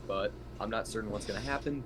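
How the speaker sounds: normal talking voice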